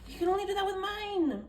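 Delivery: high-pitched mock complaint; retold exasperation. Wife grumbling about husband opening mail, retold exasperation. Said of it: "I was like".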